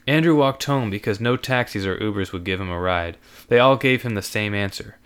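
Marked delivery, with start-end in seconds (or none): none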